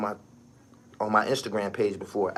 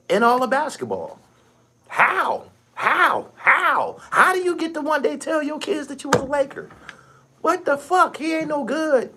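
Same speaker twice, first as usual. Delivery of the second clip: incredulous high squeal. Real, not acted. Ranting to viewers about an unworthy Laker, incredulous falsetto. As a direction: rant in a high, strained falsetto.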